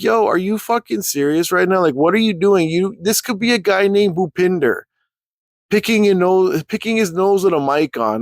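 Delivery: high-pitched, exasperated rant; incredulous scolding. Retold or imitated. Speaker telling Kit off, incredulous high rant.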